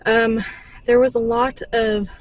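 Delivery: panting after climbing stairs; breathless, words between breaths. Real, not acted. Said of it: "I'm, like, out of breath".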